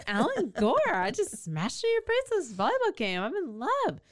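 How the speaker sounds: hammed-up lovestruck gushing, drawing out each phrase; mock swooning. Woman gushing to the man she collided with, mock swooning.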